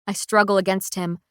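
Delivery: plain reading voice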